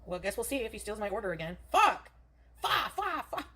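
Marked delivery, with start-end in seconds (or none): 1.7-3.5 sudden high loud swearing burst; angry cursing. Real, not acted. Streamer finds the thief took her order, furious outburst. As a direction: blurt it out, furious at the stolen order. Groceries stolen by same shopper.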